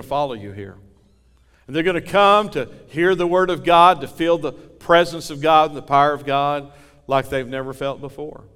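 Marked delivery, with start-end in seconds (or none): 1.7-8.4 rising preaching. Real, not acted. Preacher building to a climax.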